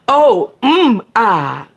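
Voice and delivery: high, loud acted moan; breathy staged moan of pleasure. A narrator's reading. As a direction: let out a hammed-up moan. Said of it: "I moan".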